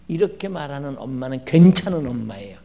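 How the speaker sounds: ordinary talk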